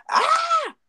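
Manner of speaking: very high squeal, imitated; toddler's scream imitated. Goddaughter screaming at her in the store, imitated squeal. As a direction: shriek like a one-year-old. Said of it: "she's like".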